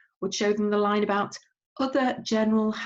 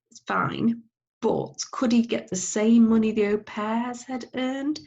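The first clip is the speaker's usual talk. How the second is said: put-on cheeky boy; 5 semitones higher, 3 dB louder than usual. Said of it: "And he said".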